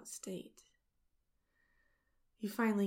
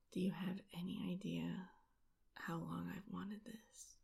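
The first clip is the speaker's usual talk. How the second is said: whispering; 7 dB quieter than usual. Acted. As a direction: hush it right into her ear. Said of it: "You whisper in my ear".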